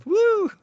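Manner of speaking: high whoop of joy; retold cheer. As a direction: whoop like you just got the part. Celebrating getting the role.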